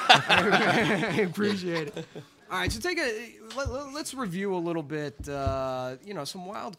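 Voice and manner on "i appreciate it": slow thanks through laughter; chuckling gratitude at the joke; laughing. Real, not acted.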